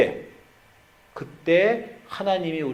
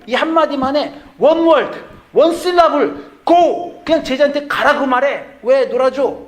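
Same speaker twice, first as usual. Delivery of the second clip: put-on much higher, louder indignant girl's voice; upset daughter; 9 semitones higher, 7 dB louder than usual. Sweet second daughter scolding dad for playing with her brother. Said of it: "둘째 딸이 화가 난 거예요".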